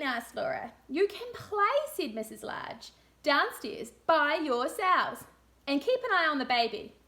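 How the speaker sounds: put-on lively read-aloud for young children; storybook reading. A narrator's reading. Teacher reading a story to room two, lively storybook voice. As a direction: read it like a picture book to a class.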